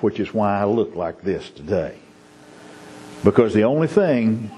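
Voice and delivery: ordinary delivery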